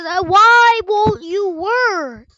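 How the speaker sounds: slow, loud, drawn-out plea; pleading with a machine. Real, not acted. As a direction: beg the game to work, dragging it out. Pleading with a stuck game.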